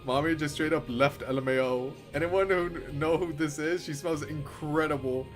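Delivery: usual speaking voice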